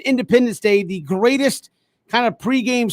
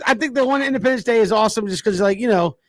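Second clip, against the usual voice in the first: ordinary delivery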